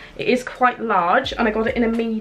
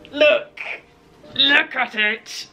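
excited showing off; 5 semitones higher, slower than usual. Real, not acted. Showing off the jumper.

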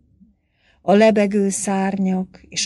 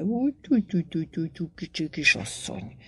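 a lightly voiced old man cooing sweetly; amorous cooing; 4 dB quieter, faster than usual. A narrator's reading. Old priest cooing amorously at the young lady. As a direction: coo it like a lovesick old suitor. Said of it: "csücsörgette a hangját szerelmetesen".

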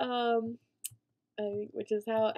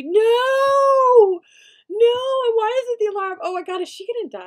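high, loud desperate screams of no; frantic high shouting; panicked shriek; 14 dB louder than usual. Real, not acted. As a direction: yell in real horror. Sim still burning, screaming.

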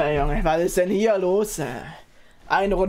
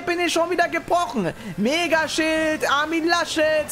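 forced cheer; 8 semitones higher than usual. Real, not acted. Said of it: "Mind Reset".

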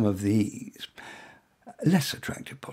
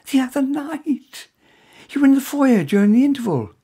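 higher voiced woman, warming as she remembers; lightly voiced young woman, then louder as it clicks; curious woman; 11 semitones higher, 4 dB louder than usual. A narrator's reading. Woman placing the stranger from the theatre, higher and brightening.

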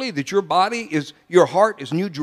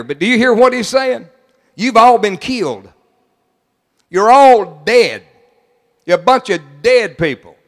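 louder and louder, slowing on dead; preaching loud; 3 semitones higher, 7 dB louder than usual. Acted. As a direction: hammer it into the crowd. Preacher driving point home.

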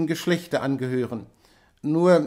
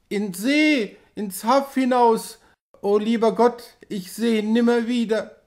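delirious crying out; 6 semitones higher than usual. A narrator's reading. Delirious wife cries out.

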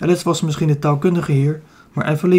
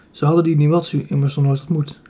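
ordinary delivery

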